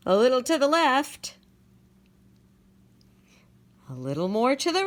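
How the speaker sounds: a lightly voiced fussing bird; mild fussing helper. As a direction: coax it gently into place. Birds patching things up.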